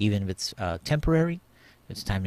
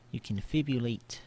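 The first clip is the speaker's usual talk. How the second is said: ordinary talk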